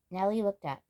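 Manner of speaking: ordinary delivery, nothing marked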